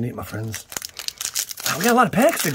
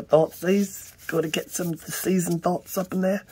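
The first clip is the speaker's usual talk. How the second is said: a lightly put-on goofy higher voice; silly voice; 8 semitones higher than usual. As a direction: play a goofy character for a beat. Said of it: "Don't ask why we're talking like that".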